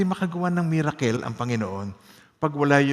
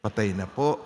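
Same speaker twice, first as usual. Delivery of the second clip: hushed hopeless words; 7 semitones lower, 6 dB quieter than usual. Shown as a demonstration. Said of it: "Isa pa".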